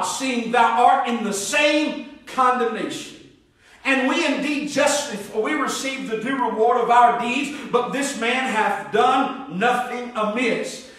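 solemn Bible reading, measured; scripture reading. Acted. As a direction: read the verses like a pulpit lesson.

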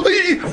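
squeaky high beep that breaks into a laugh; mimicked beep then laugh. The men mimicking the scanner beep, then laughing. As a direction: copy the beep like delighted kids, then crack up. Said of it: "They go".

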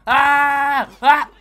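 sudden loud high yell while attacking; battle yell. Acted. Yelling at the enemy he is shooting, a loud battle cry. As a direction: let out a full-throated war cry.

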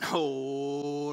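ordinary talk, nothing marked

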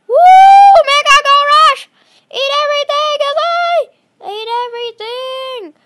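high, loud, thrilled yell; excited shouting. Real, not acted. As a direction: cheer it on at the top of your voice. Gold rush starts, yelling.

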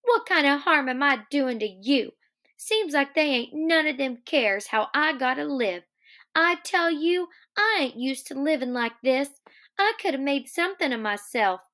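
acted resentful protest; dark, bitter. A narrator's reading. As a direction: pitch it up with mild bitterness. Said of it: "she said darkly".

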